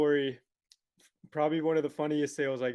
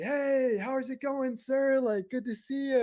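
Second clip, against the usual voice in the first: high, chirpy retold greeting; forgotten accent greeting; 10 semitones higher than usual. Salesman greeting the dad, accent forgotten, high cheery voice. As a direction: greet him high in your own American voice. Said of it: "I totally forgot I used an".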